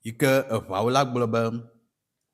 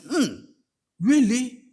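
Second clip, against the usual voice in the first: surprised disbelief; 10 semitones higher, 3 dB louder than usual. Retold or imitated. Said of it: "I said".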